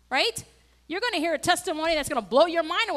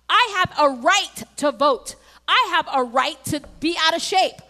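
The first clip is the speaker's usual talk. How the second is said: loud, emphatic pulpit delivery; impassioned preaching; 4 semitones higher, 7 dB louder than usual. Real, not acted. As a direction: proclaim scripture forcefully to the congregation. Preaching at full force.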